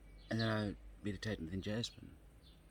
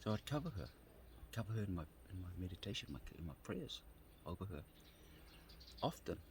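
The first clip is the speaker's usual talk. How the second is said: normal talking voice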